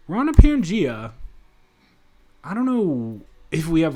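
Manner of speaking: usual speaking voice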